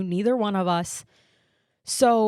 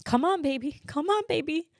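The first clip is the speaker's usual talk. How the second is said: put-on higher pitched pleading with a baby; retold anxious urging; 6 semitones higher than usual. Mother patting her silent newborn, retold anxious urging. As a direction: coax urgently, pitch climbing on the repeat. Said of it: "I'm patting my baby, and I'm going".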